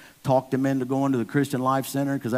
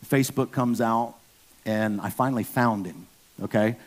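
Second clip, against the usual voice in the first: ordinary talk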